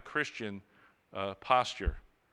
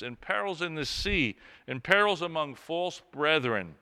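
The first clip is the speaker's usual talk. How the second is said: raised, ringing reading of Paul's questions; proclaimed scripture reading; 4 dB louder than usual. Acted. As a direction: declaim each question to the congregation. Reads Paul aloud in sermon.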